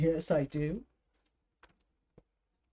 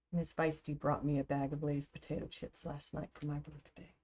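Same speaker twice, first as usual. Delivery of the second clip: normal talking voice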